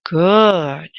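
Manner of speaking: slow calm guided relaxation. Acted. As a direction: ease them in, unhurried. Meditation guidance.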